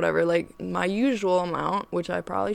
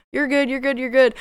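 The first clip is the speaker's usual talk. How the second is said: higher, louder, repeated reassurance; self-reassurance; 5 semitones higher, 5 dB louder than usual. Retold or imitated. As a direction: talk yourself down in the mirror. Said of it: "talking to myself in the mirror".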